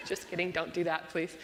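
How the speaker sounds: ordinary delivery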